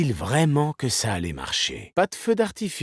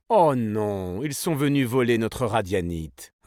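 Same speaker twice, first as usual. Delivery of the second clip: ordinary talk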